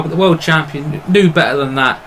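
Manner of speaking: ordinary talk, nothing marked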